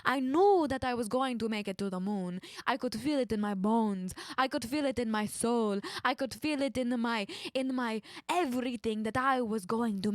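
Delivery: put-on Italian accent, bouncing syllables; mock Italian accent. Astronaut telling of reaching the moon, mock Italian. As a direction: play a sing-song mock Italian.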